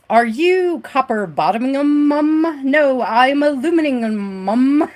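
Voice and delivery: light mock British accent for an acting drill. Streamer recalling her college acting class drill, in a light British accent. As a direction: play the posh British mum line, crisp and articulated. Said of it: "a little British tang to, twang to it".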